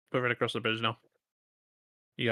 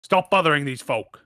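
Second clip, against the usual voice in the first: stern rebuke; 4 semitones higher, 7 dB louder than usual. Acted. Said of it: "the one to his left goes".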